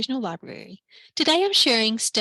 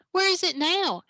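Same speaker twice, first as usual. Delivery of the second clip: anxious; 8 semitones higher than usual. Acted. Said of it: "asked Stella anxiously".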